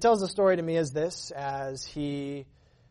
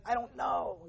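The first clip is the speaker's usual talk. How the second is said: shrugging dad; 8 semitones higher, 4 dB quieter, slower than usual. Retold or imitated.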